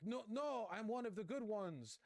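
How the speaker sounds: high-pitched mock plea of a desperate man; high, whiny imitation of a pleading supporter; mock pleading guy. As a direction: squeak it out like a man begging to be spared. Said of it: "and he's like".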